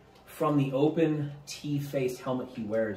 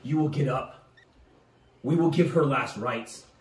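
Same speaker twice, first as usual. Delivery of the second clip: raised, resolute command; firm rallying; 4 semitones higher, 6 dB louder than usual. Acted. Cormac promising to help his brother, firm rallying. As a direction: order him up with steady conviction.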